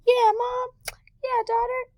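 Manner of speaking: put-on high, bright playful kid voice. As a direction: pipe it up like a happy child. Kids and mom agreeing happily.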